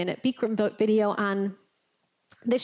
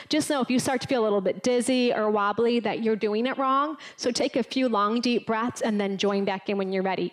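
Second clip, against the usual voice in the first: ordinary talk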